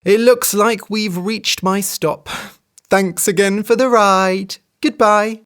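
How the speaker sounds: a lightly voiced sly hare saying goodbye; cheeky hare farewell. A narrator's reading. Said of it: "said the hare, rubbing her stomach".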